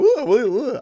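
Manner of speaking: high, loud shuddering oohs; squeamish oohs. Retold or imitated. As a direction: act out recoiling in squeamishness. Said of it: "it just made me go".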